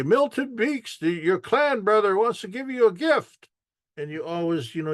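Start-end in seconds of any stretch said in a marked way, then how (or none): 0.0-3.5 high announcer call; powwow announcer. Retold or imitated. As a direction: sing out the name like the arena announcer. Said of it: "they'll say".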